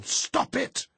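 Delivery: very high, loud scream; explosive desperate shout. A narrator's reading. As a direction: yell with everything to make it stop. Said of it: "she shouted".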